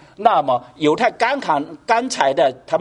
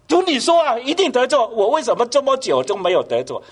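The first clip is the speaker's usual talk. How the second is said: mock complaining prayer; 9 semitones higher than usual. Believer's impatient prayer voiced.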